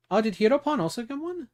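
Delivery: high, quick, eager check; excited high question. Real, not acted. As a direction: ask brightly and fast. Checking gift subs excitedly.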